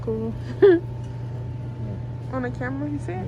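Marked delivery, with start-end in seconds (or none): 0.4-2.3 laughing. Real, not acted. Excited at snowy car.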